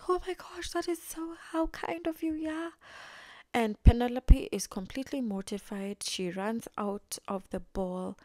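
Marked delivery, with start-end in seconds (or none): 0.1-2.7 much higher, gushing fake-sweet mimicry; pretentious. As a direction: put on a high, pretentious society-lady gush. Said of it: "of course, so pretentious".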